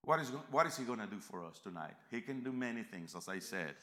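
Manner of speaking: ordinary talk, nothing marked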